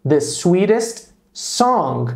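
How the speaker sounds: slow, careful model sentence; slow model phrase. Shown as a demonstration. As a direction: spell out each word slowly for students. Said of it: "qual é o quê?". (frase modelo dita bem devagar)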